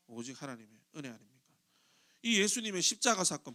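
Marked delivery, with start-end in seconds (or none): 0.1-1.4 low, soft rhetorical question; hushed repeat. Real, not acted. Preacher asking the congregation about grace alone, hushed. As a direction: drop right down and let it sink in. Same point repeated softly.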